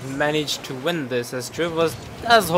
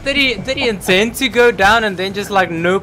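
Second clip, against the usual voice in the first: excited play-by-play; 7 semitones higher, 8 dB louder than usual. Real, not acted. Caster reacting to a player's fake, loud excited call. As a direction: react hard to a risky play.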